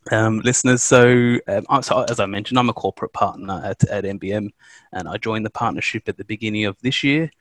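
ordinary talk, nothing marked

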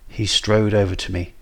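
ordinary delivery, nothing marked

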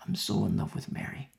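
ordinary talk

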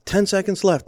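loud, high announcer call of time left; retold arena announcer shouting the countdown. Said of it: "they said".

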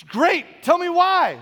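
high, loud mock demand; exasperated wife. Wife answering her mad husband, high exasperated imitation. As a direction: throw it back high and loud.

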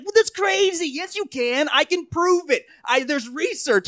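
high-pitched, over-eager mock believer; insistent atheist fan. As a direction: protest in a high, pestering mock voice. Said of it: "He's like".

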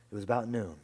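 usual speaking voice

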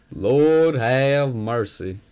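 slow, loud drawn-out exclamation; mock southern exclamation. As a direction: stretch it out loud, like a preacher.

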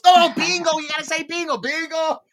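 high, urgent voicing of grandma; grandma insisting she say bingo; frantic correction. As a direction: correct her in a hurry. Grammy correcting her, exasperated.

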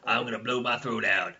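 raspy, loud radio DJ growl; Wolfman Jack voice. Pretend. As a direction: growl it like a wild late-night DJ. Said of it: "that was my Wolfman Jack voice".

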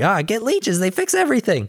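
high goofy cartoon voice; Pinky voice. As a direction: play the dim sidekick, high and silly.

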